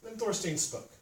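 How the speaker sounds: plain reading voice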